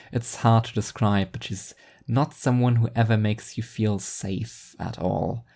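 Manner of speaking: usual speaking voice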